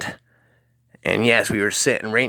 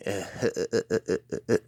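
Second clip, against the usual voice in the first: softer stuttering "huh-huh-huh" laugh; crazy Butthead laugh; 6 dB quieter than usual. Retold or imitated. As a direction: imitate Butthead's dopey chuckle, softly. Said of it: "Matt starts laughing".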